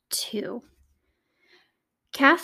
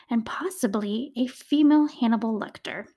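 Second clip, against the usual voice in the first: normal talking voice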